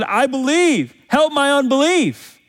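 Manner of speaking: high pleading cry from the father. A narrator's reading. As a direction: cry out for help. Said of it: "cried out and said".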